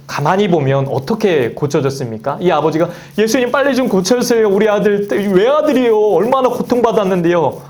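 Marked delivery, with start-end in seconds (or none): 3.2-7.6 much higher, urgent begging for his only son; desperate father pleading. Retold or imitated. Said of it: "이렇게 해서 고쳐진 게 아니라". (외아들 위해 다급하게 애원하는 아버지)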